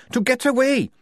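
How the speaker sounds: red-faced anger. A narrator's reading. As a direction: hammer out every grievance. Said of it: "red in the face".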